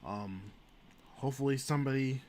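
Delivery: somber. Real, not acted. Mourning Ginsburg.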